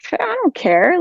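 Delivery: retold exclamation of not caring; defiant outburst. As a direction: brush it aside with a high, emphatic cry. Retold defiant reply to family.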